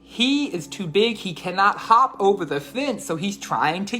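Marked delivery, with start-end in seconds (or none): none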